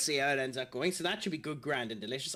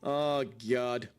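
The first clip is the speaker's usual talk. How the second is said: ordinary talk, nothing marked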